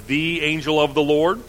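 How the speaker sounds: "the" leaned on in "the angel of the Lord"; weight on "the" before "angel"; stress on the. Shown as a demonstration. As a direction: hit the definite article "the". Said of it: "the emphasis is on the definite article".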